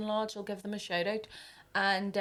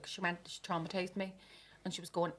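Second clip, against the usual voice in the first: ordinary talk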